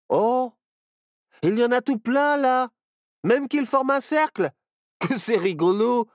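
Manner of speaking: thrilled at the mushroom ring; delighted. Acted. Barnabé finally happy, excited high voice. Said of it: "se réjouit enfin Barnabé".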